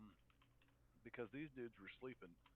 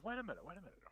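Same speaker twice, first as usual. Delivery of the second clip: high-pitched, swooping in-character interjection; character protesting; 6 semitones higher, much faster than usual. Acted. Player's level four character starts saying it, protesting. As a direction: jump in with a put-on protest. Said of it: "I start saying".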